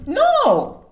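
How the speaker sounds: high sharp no; playful no. Pretend. Vlogger acts out refusing the wind with a high no. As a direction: flick it up high.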